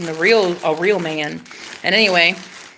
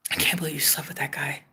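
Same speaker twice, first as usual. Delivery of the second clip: imitating him in a low near-whisper; quiet, retold confession; hushed disbelief; 7 semitones lower, 10 dB quieter than usual. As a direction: drop to a soft, low murmur for his words. Ex's hurt words retold.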